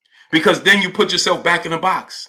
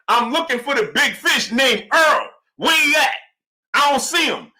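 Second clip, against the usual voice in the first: mock fighter's callout; 8 semitones higher than usual. Streamer acting out the fighter hunting Earl, hammed-up half-shout. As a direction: ham up the fighter calling out Earl. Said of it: "he ain't say it like this, though".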